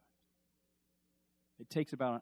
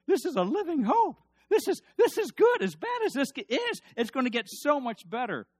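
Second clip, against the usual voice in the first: much higher and louder, almost crying out with joy; fired-up preaching; 10 semitones higher, 8 dB louder than usual. Acted. Preacher lifting up a suffering congregation, soaring excited cry. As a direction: burst out with triumphant hope.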